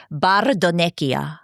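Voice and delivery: Bardonecchia in a slow, rolling Italian accent, every syllable savoured. Shown as a demonstration. Host relishing the Italian ski town name in an Italian accent. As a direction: say Bardonecchia the Italian way, slowly and lovingly. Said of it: "I have to say it in the Italian accent".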